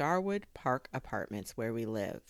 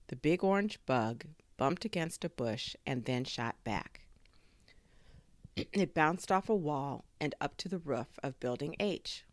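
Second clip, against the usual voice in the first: usual speaking voice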